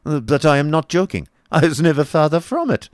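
high, insistent protest; remonstrating. A narrator's reading. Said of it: "remonstrated the Baron".

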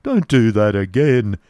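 loud, slow protest; alarmed plea, drawn out; scared expostulation. A narrator's reading. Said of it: "expostulated the figure, looking very much scared".

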